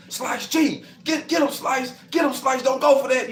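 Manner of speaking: high, loud egging-on chant; root for him from the windows; cheering crowd. Retold or imitated.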